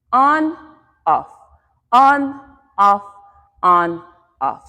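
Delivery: lifted on each ON, dropping on each OFF; rhythmic step cues. Shown as a demonstration. Teacher cues hips rotating outwards, calling the beat. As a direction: call alternating beats in time. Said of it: "We're gonna go".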